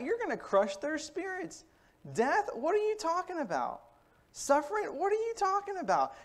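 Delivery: high, urgent pleading friend, pitched well up; Peter pleading with Jesus. Acted. Peter pulls Jesus aside, pleading high and urgent. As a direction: beg him to stop talking like that, voice climbing. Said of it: "Peter brings Jesus to the side to say".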